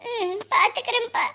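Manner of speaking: high, tear-laden reply; tearful. Acted. Mother telling departing son to mind his health, tearful. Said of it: "நீ அழக்கூடாது".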